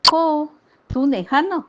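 innocent grandmother voice, lightly played; innocent old lady. A narrator's reading. Frail mother-in-law answering the sister, innocently smiling. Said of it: "त्या इतक्याच निरागस हसल्या".

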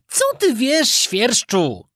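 put-on high, scornful puppet boy retort. Puppet boy mocks the cricket, high and scornful. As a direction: sneer at the cricket. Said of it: "zawołał pogardliwie".

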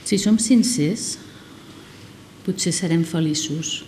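slow, deliberate poetry reading; poem recitation. Acted. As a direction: recite line by line with long pauses.

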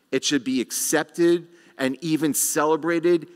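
usual speaking voice

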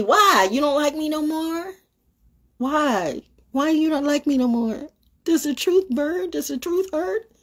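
high-pitched mock-hurt questioning; mock pleading. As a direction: tease them with high, wheedling questions. Mocks people mad at her truth.

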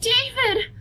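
high, bright calling out a name; overjoyed to see him safe; glad cry. Acted. Sister voicing relief at finding David, glad high call. Character finds David alive.

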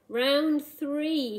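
loud, high announcing shout. A narrator's reading. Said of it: "he shouted".

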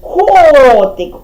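КОТИК with the О stretched; stress on first syllable. Shown as a demonstration. Teacher showing where the voice hits, long КО in КОТИК. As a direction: lean on КО in Котик, then drop away. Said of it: "О вдарили".

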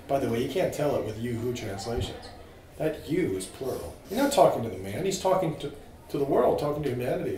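usual speaking voice

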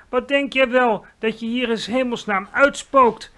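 high, loud scolding maid; furious servant's yell; angry shout. Acted. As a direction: snap at the intruder. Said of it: "schreeuwde ze kwaad".

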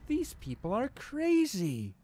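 usual speaking voice

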